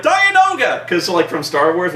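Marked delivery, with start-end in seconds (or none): 0.0-0.9 high, loud excited cry; fan's gleeful shout; excited shout of recognition. Retold or imitated. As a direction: yell the name like a fan spotting it. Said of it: "first I was like".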